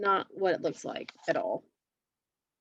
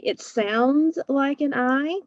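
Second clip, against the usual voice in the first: slow and stretched, higher and louder; stretched sounds; 6 semitones higher, 5 dB louder, slower than usual. Shown as a demonstration. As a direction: draw out sounds like a puzzled teacher. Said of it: "but it's actually an E".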